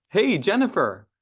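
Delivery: put-on high, bright hello to an old friend; friendly greeting. Shown as a demonstration. Trainer demos the right way to greet a client. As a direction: greet her like a longtime client. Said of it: "jump on that call and say".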